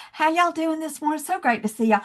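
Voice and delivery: lightly voiced Southern hello; Southern accent. Southerner greeting New York cafe, mild Southern accent. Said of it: "with my Southern accent".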